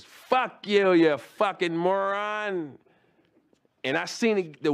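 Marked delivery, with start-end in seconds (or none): 0.0-2.8 slow, higher sneering put-down; Daryl's insult. Retold or imitated. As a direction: stretch out fucking moron with contempt. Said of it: "Turns around and goes".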